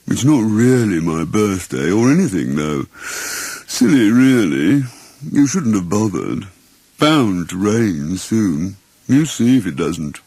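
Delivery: slow, sorrowful donkey voice; gloomy Eeyore. Acted. Eeyore grumbles about his birthday, slow and gloomy. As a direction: sigh it out like a donkey expecting the worst.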